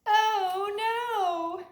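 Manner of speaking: hammed-up storybook alarm; mock dismay. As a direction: gasp it like a storyteller feigning shock. Last button lost.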